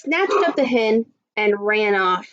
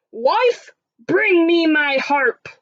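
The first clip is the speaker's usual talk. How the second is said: high, drawn-out commanding call; giant shout; 6 semitones higher, slower than usual. Acted. Giant demanding his harp from his wife, shouted. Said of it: "Then he shouted".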